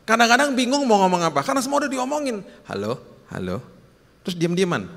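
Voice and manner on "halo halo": awkward phone hello. Acted. Acted awkward phone call with wife.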